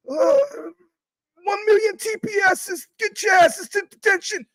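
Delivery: a squealing, freaking-out school principal screaming at students; Principal McVicker. Retold or imitated. Streamer does the cartoon principal yelling at chat. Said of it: "Principal McVicker".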